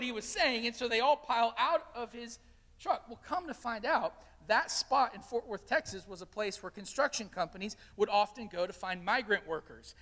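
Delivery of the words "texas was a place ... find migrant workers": normal talking voice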